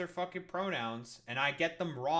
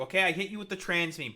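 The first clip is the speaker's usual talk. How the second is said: normal talking voice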